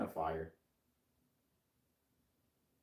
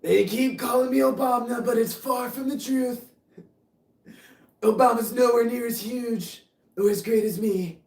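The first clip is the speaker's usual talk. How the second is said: much higher, louder put-on braggart voice; over-the-top blustering politician, pitched up and loud; boastful politician impression; 12 semitones higher, 7 dB louder than usual. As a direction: brag like a pompous president on a podium. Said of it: "your impressions are O M G".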